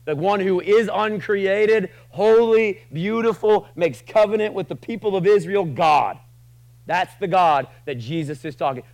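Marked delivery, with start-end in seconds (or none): 0.1-6.2 higher, louder, slow at first; exalted preaching. Acted. Preacher praising God to the congregation, exalted. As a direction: lift up God's names with awe.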